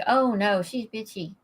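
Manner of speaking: usual speaking voice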